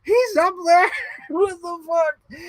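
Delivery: high, loud stunned shout, slow at the end; high laughing disbelief; incredulous. Retold or imitated. Shocked at sister.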